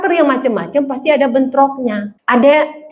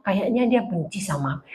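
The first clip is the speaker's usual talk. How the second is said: sulky mutter; 7 semitones lower, 11 dB quieter than usual. Retold or imitated. Voicing a hurt churchgoer's thought.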